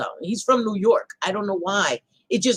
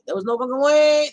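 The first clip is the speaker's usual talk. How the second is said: high, raised exclamation with stretched ending; loud disbelief; 7 semitones higher, 6 dB louder than usual. Real, not acted. Vlogger scoffing at claimed double degrees, loud disbelief. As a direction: cry it out in disbelief, stretching the last word.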